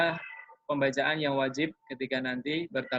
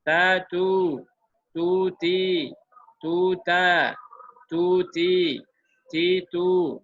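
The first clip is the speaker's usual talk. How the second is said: louder, each syllable set apart; demo reading; 4 semitones higher, 6 dB louder, much slower than usual. Shown as a demonstration. Teacher shows how to read dhammah syllables, loud and even. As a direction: read it out as the model for students. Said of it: "ini silahkan dibolak-balik".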